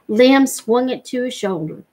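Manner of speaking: usual speaking voice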